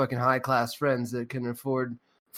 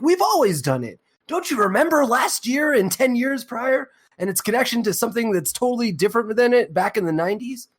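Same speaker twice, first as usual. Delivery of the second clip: mocking media voice; 7 semitones higher than usual.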